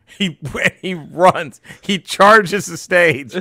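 normal talking voice

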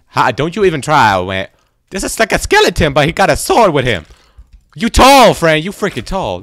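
loud, high-pitched bluster; rattled yelling. Real, not acted. Player warning off a tall skeleton with a sword, rattled yelling. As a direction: shout down the approaching enemy.

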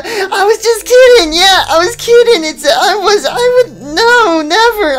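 high, loud flustered denial; panicked backpedal. Acted. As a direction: scramble to take it all back. Nervously takes back the confession.

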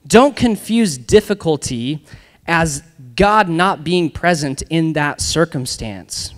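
slow, deliberate line to remember; slow emphatic. Shown as a demonstration. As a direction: lay each word down slowly for the crowd. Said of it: "throw that up there".

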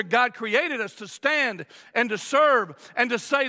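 normal talking voice

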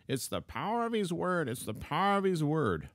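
high-pitched mimicking of a stock phrase; parroted cliché. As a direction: recite it high, like a slogan learned by rote. Mimics the cliché he was taught.

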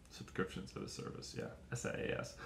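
"subscriptions as a service" whispered, then spelling S I A S; whispered aside. Shown as a demonstration. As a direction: mutter the acronym to yourself.

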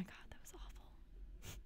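whispered, very soft and slow; hushed and drained; real whisper. Real, not acted. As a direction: breathe it out in shaken relief. Shaken after near-death scene.

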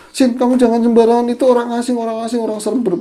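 high, fast retold warning; urgent warning. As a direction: imitate an alarmed friend cautioning someone. Friend warns against bringing stranger home.

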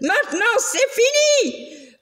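high, mock-shrill outburst; monster's cry. The furious monster cries out at Lucille, high mock shriek. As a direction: shriek it, slowed, at the end of his rope. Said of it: "Le monstre cria".

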